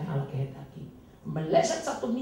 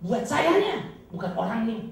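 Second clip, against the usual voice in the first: ordinary talk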